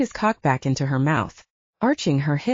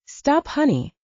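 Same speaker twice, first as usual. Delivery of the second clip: higher, firm growled stop; 8 semitones higher, slower than usual. A narrator's reading. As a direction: bark the order to stop. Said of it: "Justin growled".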